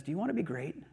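soft, measured, with long pauses; hushed, patient appeal in God's role; God speaking gently. Retold or imitated. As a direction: counsel quietly, letting each phrase settle. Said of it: "God just looks at us and he says".

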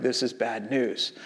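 slow, low, very soft retold worry; quietly sinking realization; hushed dread. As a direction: drop right down, slow and grave. Friends see the gas running low.

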